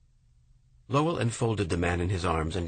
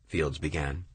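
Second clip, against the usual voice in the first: usual speaking voice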